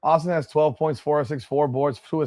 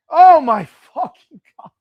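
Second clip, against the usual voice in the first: high and loud outburst; exasperated shout; 10 semitones higher, 11 dB louder than usual. Real, not acted. Fan seeing the ball go to Boston again, loud exasperation. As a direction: explode at the pass.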